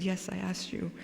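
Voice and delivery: softly retold, meek admission; quiet, deferential reply without any reproach; very humble. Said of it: "very humbly".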